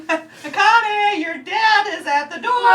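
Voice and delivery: high, loud shouted announcement; shouted cartoon quote. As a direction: yell it up the stairs. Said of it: "purely quoting the show".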